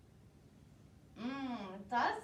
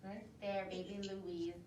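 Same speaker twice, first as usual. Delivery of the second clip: tender; 6 semitones lower, 8 dB quieter than usual. Real, not acted. Pointing at baby in picture.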